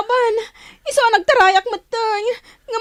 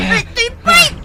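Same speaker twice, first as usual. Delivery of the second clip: ordinary talk, nothing marked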